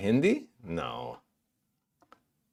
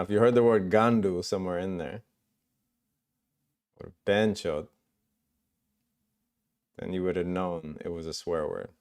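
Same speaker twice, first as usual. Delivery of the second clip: ordinary talk, nothing marked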